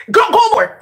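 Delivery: high, loud urging like a punishing teacher; mock drill master. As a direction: bark it on as the one in charge.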